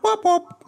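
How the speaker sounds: high helicopter-like wop wop; helicopter noise. Retold or imitated. Imitating a helicopter-like cry.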